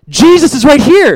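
put-on high and loud, a little boy's thrilled announcement; excited child. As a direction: announce it like an eager kid. Son excitedly announcing to dad.

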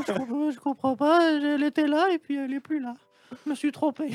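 piping up like a tiny flustered man; small high-pitched voice. Retold or imitated. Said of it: "il avait une petite voix aiguë comme ça".

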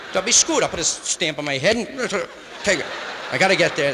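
mock urgent shout. Jokes about mailing himself.

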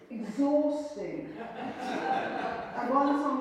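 slow and laughing. Real, not acted. Author laughing about her exhausting wild years. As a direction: laugh it out, slowly.

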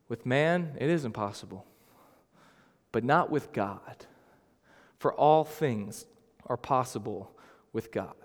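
Jesus answering his shaken disciples softly; hushed. Retold or imitated. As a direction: let each phrase land gently.

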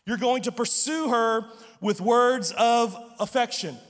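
ordinary delivery

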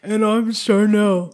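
a slow, lightly deepened take on her cohost; Charnel impression. Host introducing cohost in his voice, a mild imitation. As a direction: try to sound like the other host. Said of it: "the best Charnel voice I could do".

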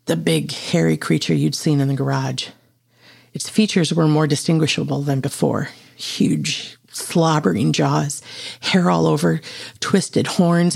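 usual speaking voice

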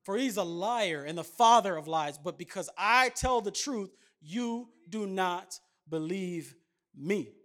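loud, declaiming scripture reading; fervent sermon reading voice; preaching scripture. Acted. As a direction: proclaim the words of Jesus from the pulpit. Preacher reads Jesus' words aloud.

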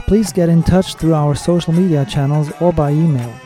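usual speaking voice

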